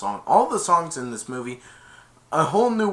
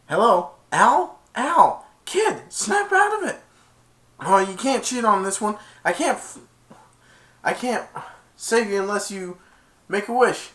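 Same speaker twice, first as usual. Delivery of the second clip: high, excited Genie voice; a kid doing the Genie, pitched way up; 9 semitones higher, 5 dB louder than usual. Retold or imitated. Genie trying to wake up Aladdin, high and pushy.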